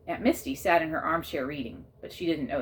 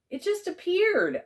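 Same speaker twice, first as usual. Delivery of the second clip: much higher, slow defensive protest; 14 semitones higher, 5 dB louder, slower than usual. A narrator's reading. Narrator to Peyton, defensive high protest. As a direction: insist you did nothing. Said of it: "I said defensively".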